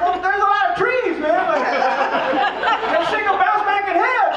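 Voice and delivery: high, slow whining excuse; mock nervous soldier whining back; whiny excuse. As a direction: plead your way out, hammed up. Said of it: "I was like".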